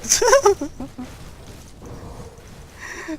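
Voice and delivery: high airy giggling; high giggle. Real, not acted. Gamer embarrassed by fake Italian, high airy giggle. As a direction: giggle at your own blunder. Laughs after bad Italian.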